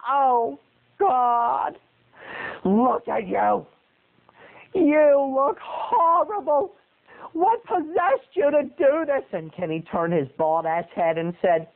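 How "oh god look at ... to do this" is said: high wailing sob, mother in despair; screaming and crying. Retold or imitated. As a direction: wail it through tears, pitch way up. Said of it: "started screaming".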